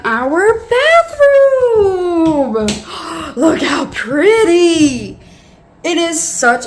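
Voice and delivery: high, loud, long-drawn-out gushing; excited reveal. Real, not acted. Revealing the new bathroom to viewers, gushing. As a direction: stretch it into a sing-song squeal, then gasp.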